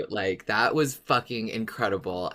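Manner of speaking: ordinary talk, nothing marked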